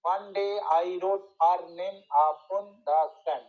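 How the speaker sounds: very slow verse scansion with pauses after the beats; slow, measured metrical demonstration; scanned stresses. Shown as a demonstration. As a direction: chant it foot by foot, resting on day, wrote, name and sand. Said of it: "ডে তাতে এসে একটা pause হচ্ছে".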